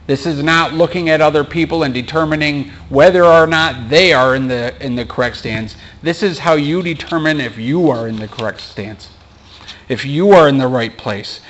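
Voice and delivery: ordinary talk, nothing marked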